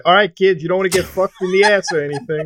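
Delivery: lightly voiced Italian accent; a hint of New York Italian accent. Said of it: "in that great Italian accent of his".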